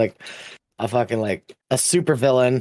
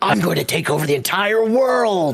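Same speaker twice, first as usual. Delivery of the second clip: high, grandiose mock villain voice; hammed-up villain; 7 semitones higher than usual. Retelling the hero turned fascist, hammed-up villain. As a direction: announce world domination like a cartoon baddie. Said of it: "he basically is like".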